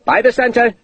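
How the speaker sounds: high parade-ground shout; shouted command. A narrator's reading. Sergeant ordering the ragged line, shout. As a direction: dress the ranks on the centre. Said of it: "the sergeant shouted".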